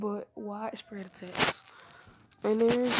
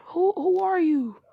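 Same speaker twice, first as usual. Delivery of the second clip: higher, louder confrontation of an intruder; alarmed challenge; 9 semitones higher, 7 dB louder than usual. Acted. Karin demands who broke in, alarmed and raised. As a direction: challenge the attacker, pitched up.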